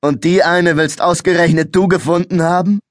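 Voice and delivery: mock him with a grin; sneering. A narrator's reading. Second policeman sneering at the boy's claim, mocking. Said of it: "mit einem höhnischen Grinsen".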